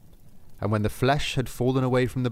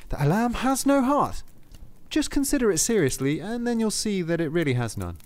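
insistent soldier; 8 semitones higher than usual. Acted. Lustig to Saint Peter, high insistent voice. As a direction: press the point hard.

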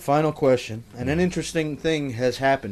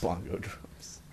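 whispered; 8 semitones lower, 16 dB quieter, slower than usual. Pretend.

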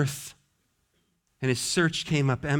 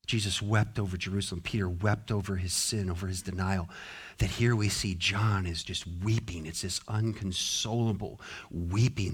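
low, much softer, with heavy pauses; hushed, somber preaching; 11 semitones lower, 9 dB quieter than usual. Real, not acted. Preacher describing John weeping as no one answers, hushed and somber. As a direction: let it sink into quiet desolation.

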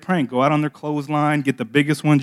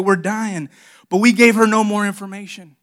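normal talking voice

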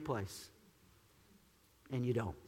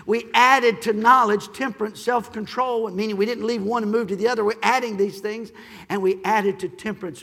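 louder, emphatic preaching on each step; preaching build; 5 semitones higher, 9 dB louder than usual. Acted. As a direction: stack each word like a building block.

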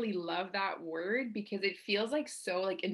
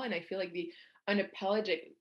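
usual speaking voice

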